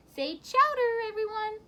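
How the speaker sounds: high, loud photo-taking call; Madge's cheery call. Acted. Wife snapping pictures of the dog, bright call. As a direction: coax everyone to smile for the camera. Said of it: "Madge said".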